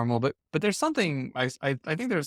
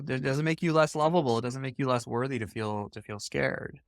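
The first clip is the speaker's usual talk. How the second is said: ordinary delivery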